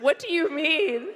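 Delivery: high, loud startled "What?"; mock horrified. Strangers hearing purse says Death to America, mock horrified. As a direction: gasp it out, shocked. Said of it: "We're horrified".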